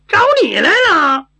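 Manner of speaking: high, loud, artless reply; loud blurt. Acted. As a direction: blurt it out with no thought of secrecy. 傻丫头不顾场合大声回答. (傻乎乎地高声脱口而出)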